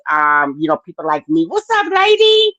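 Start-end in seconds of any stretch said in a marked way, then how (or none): 1.5-2.5 high, bright sing-song hello to a viewer; excited greeting. Real, not acted. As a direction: call out a cheery hello. Greeting live chat viewer.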